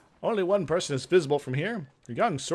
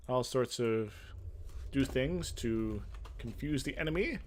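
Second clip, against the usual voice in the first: ordinary talk, nothing marked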